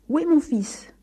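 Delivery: defiant father demanding an answer; defiant grumble. A narrator's reading. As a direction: challenge the priest gruffly. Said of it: "grommela-t-il en défiant le curé".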